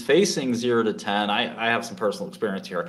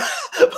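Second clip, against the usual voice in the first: burst of laughter; 6 dB louder than usual. Real, not acted. Laughing mid-quote.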